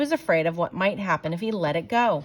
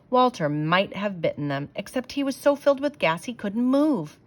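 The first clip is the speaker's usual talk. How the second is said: plain reading voice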